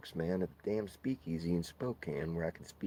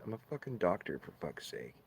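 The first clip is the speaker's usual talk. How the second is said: ordinary talk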